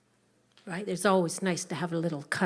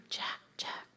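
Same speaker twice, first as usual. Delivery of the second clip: softly whispered name; waking whisper; 11 dB quieter than usual. Acted. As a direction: rouse him gently without startling. Mother gently waking her son.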